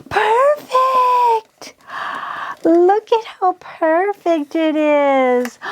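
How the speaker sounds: high, gasping delight that slows down; excited gasp. Real, not acted. Crafter admiring the fitted bezel, gasping excitement. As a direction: gush over how perfect it looks. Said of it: "I'm getting way too excited".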